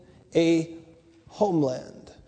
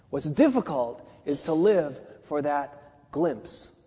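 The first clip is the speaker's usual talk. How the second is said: ordinary delivery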